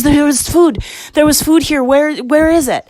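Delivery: high, loud breathless alarm; imitating her frantic rush of questions; panicked sister. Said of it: "like, out of breath".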